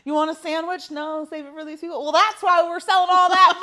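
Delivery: high, hammed-up Oklahoma twang; excited mock Oklahoma holler; Oklahoma accent. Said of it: "Your Oklahoma accent and that story".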